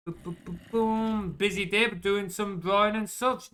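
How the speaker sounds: ordinary delivery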